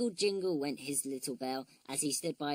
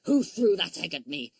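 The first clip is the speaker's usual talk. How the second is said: furious dog with egg on his head; outraged yell; angry shout; 9 dB louder than usual. A narrator's reading. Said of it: "angrily looking all around".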